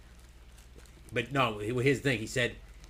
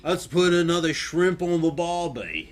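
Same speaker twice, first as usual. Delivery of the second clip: bad Australian accent; 4 semitones higher, 3 dB louder than usual. Shown as a demonstration. Trying an accent they can't do well, bad Australian. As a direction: attempt a cheesy Australian drawl. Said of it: "I can't do an Australian accent all that great".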